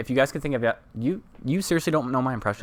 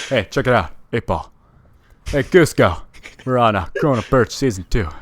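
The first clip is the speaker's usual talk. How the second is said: lightly voiced louder Putty; a hint of an eager Seinfeld sidekick; Putty impression; 4 dB louder than usual. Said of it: "my Putty from Seinfeld".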